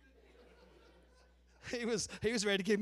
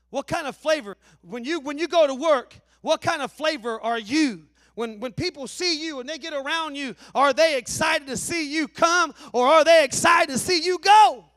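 rising preacher's call, building louder toward the end; preaching; 4 semitones higher, 11 dB louder than usual. Acted. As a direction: lift the room with each question. Preaching to the congregation.

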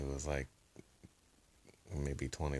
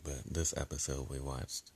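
ordinary talk

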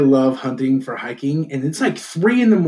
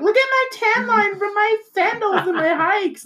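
very high, squealed parody; mock show-off hiker. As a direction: squeal it like a preening fake hiker. Mocking sandal-tan hikers showing off.

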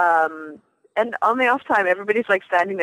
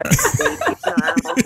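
high squealing zombie screech; shrill zombie screech. Pretend. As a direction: screech like nails on chalkboard. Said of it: "nails on a chalkboard".

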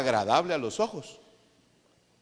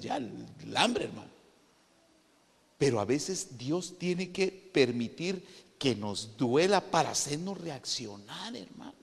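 usual speaking voice

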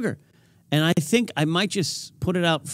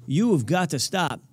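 ordinary delivery

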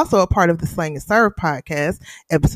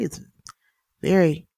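ordinary delivery

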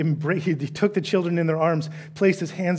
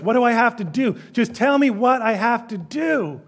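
higher, pressing demand; insistent rich man; 6 semitones higher, 4 dB louder than usual. Retold or imitated. Rich man presses Jesus for what to do, higher. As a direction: push Jesus for the rule.

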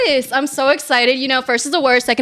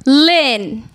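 loud name intro; 8 dB louder than usual. Acted. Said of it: "Say it one more time".

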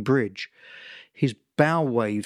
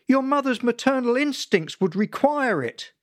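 high, raised insistent voice; insistent father; 11 semitones higher than usual. Acted. Father blaming mother's instincts, insistent. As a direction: press the point, unbending. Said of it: "father insisting".

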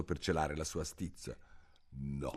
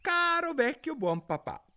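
put-on much higher child's shriek; shrill cry; 4 dB louder than usual. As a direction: squeal it like a cheeky son. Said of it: "strillò un giorno". (strillo acuto del figlio)

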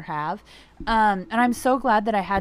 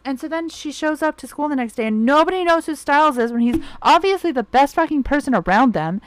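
loud, higher, worked-up retelling; heated outburst; 5 semitones higher, 7 dB louder than usual. Real, not acted. Fan venting to viewers about the finale, loud and worked up. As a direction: vent it like the loss still stings.

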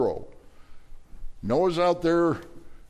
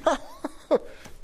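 high airy giggle. Acted. Sarah laughing at the promise.

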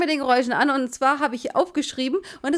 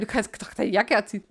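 ordinary talk

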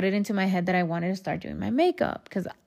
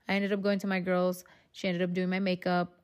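usual speaking voice

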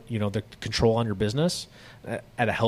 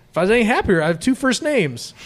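high, loud goofy boast; off-the-wall reply; 9 semitones higher, 5 dB louder than usual. Shown as a demonstration. Customer answering a rote greeting with a loud goofy boast. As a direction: say it absurdly cheerful. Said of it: "just go like this".

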